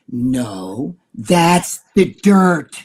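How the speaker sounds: very slow, drawn-out words; slow, emphatic explanation; imitating the priest. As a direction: stretch each word out as the priest did. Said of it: "Like that, right?".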